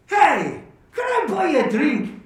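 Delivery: high-pitched, hearty bar-room offer; eager joke character. Acted. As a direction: call out brightly to a fellow drinker. Joke character calls out to patron.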